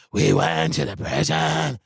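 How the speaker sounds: mocking a friend's affected creepy voice; put-on affectation. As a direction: drag it out like a ghost story. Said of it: "putting on an affectation".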